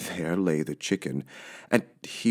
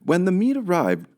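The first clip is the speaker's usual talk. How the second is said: plain reading voice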